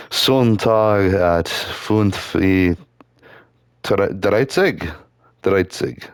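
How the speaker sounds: slow, lightly put-on German accent; horrible German accent. Streamer sets an alarm in clumsy German. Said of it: "I speak it with a horrible accent".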